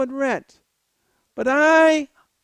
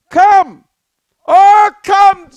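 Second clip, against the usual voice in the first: loud high call; 7 semitones higher, 11 dB louder, much slower than usual. Retold or imitated. Calling the woman to come with her, loud. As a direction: summon her loudly.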